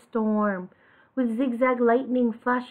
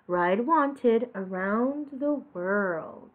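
slow message; slower than usual. Acted.

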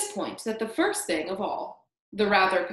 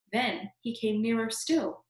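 usual speaking voice

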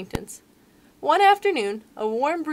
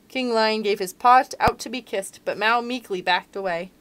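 ordinary delivery, nothing marked

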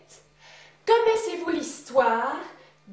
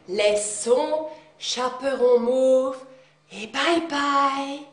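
slow storyteller; 3 semitones lower, slower than usual. A narrator's reading.

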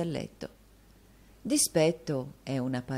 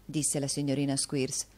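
ordinary delivery, nothing marked

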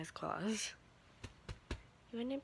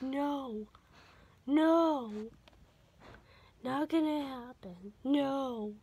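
loud, higher stubborn refusal; voicing the dog; 6 semitones higher, 9 dB louder, much slower than usual. Owner voicing her dog refusing to let the toy go, loud stubborn refusal. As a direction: play the dog refusing to give it up. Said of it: "She's like".

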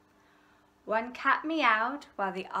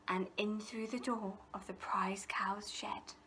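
breathy secret whisper; 10 dB quieter than usual. A narrator's reading. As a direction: let the last line sink into a whisper. Ladybird repeats thieves' secret plan.

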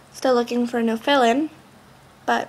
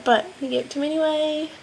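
ordinary talk, nothing marked

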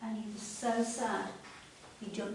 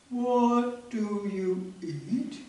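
very slow tortoise; 6 dB louder, much slower than usual. Acted. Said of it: "Tortoises talk like that".

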